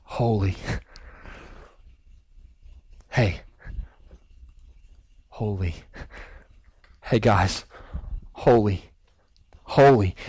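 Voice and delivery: low, hushed "holy", slowly growing; hushed angel whispers. Acted. One angel alerting the others in a hushed, awed whisper. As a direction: murmur it secretly to the others. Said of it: "they start to whisper amongst themselves".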